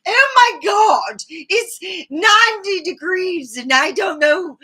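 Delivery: high, loud, drawn-out heatstruck wail; exasperated tourist shout. Retold or imitated. London tourist to the narrator, shouting slowly about the heat. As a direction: moan slowly and loudly about the heat like a wilting visitor. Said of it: "especially the one from London who go".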